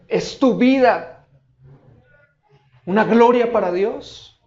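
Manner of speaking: loud, rising preacher's questions; fervent preaching. Acted. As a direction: press the congregation hard.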